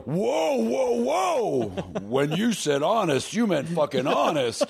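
high, drawn-out hammed-up protest; mock indignant shock. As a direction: recoil with high whoas, then object slowly. Acting a stung writer's overreaction.

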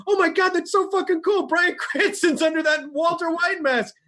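high-pitched gushing, reenacted; acted fan excitement. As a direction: gush like an amazed fan watching a clip. Acts out own excitement watching video.

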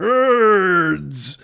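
long, loud drawn-out bellow; Ogre. Acted. Comedian imagining himself jeering at college students, drawn-out bellow. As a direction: stretch one word into a huge jeering holler. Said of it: "be like Ogre ... going".

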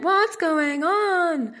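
high, very slow and drawn out; gossipy drawn-out question. Real, not acted. Host asking listeners about Jen and Justin, drawn out and gossipy. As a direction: stretch it like juicy gossip.

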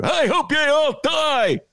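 put-on high, slow, shouted old-man rant; hammed-up furious boomer spitting venom; outraged boomer. Mocking boomers to the co-host, hammed-up outraged shout. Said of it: "That's my imitation of an outraged boomer".

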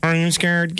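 even machine gun syllables; robot asking in flat, even syllables. Pretend. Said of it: "I love your robot voice".

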